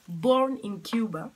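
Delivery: slow, loud, self-mocking English accent; hammed-up English accent, drawn out. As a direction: say it like a proud tourist in English-accented English. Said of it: "avec mon accent, mon super accent anglais".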